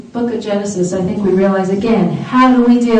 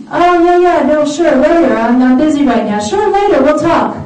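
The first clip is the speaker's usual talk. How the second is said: hammed-up high, louder stalling; evasive brush-off; 5 semitones higher, 4 dB louder than usual. Spouse dodging "we gotta talk", evasive mock agreement. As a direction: put off the conversation with over-eager agreement.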